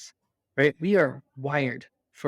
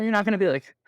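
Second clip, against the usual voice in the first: ordinary talk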